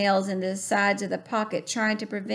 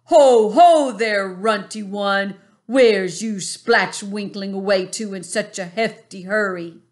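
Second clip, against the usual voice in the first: booming giant; 8 dB louder than usual. A narrator's reading. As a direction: boom it out like a bullying giant. Flesh lump eater voiced.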